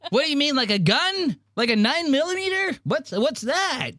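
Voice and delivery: very high, frantic mock voice with a laugh; panicked Mark. Teasing Mark's terror at a nicotine pouch, squealing high. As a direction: squeal it like he thinks nine means a gun. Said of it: "He goes".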